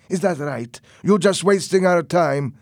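put-on loud, high, accusing in an accent; angry. A narrator's reading. Roberto angrily challenges Tony about wasting their time. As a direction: confront him with mounting anger. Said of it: "looking at Tony angrily".

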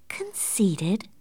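soft, whispered questioning echo; hushed disbelief. A narrator's reading. Catherine staring at her cousin, hushed questioning whisper. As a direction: echo it under the breath as a question.

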